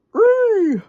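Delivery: very high whistled call; high bird call; bird whistle. Acted. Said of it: "whistled Bird".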